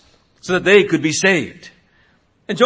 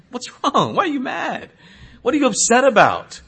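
higher, lightly voiced parent asking; parent to daughter; 7 semitones higher than usual. Mother asking her upset daughter, lightly voiced and higher. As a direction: coax a sulking child to explain. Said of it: "I say that to my daughter".

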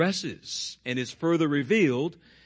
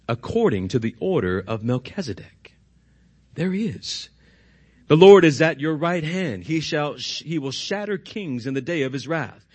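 scripture reading; 3 semitones lower than usual. Acted. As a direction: read it out with weight, letting it fall away. Pastor reads rest of psalm.

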